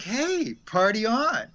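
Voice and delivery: high, cheery mock devil voice; hammed-up tempter egging on a drink; party devil. Devil to an alcoholic, lightly voiced party devil.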